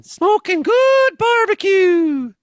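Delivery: very high, slow, loud helium squeak. Shown as a demonstration. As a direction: drag it out in a balloon voice. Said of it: "let's hear your helium voice".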